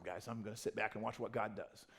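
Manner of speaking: ordinary talk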